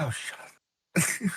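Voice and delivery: slow, soft, fed-up mutter; exasperated mutter. Retold or imitated. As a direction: sigh it out, giving up. Exasperated parent retold.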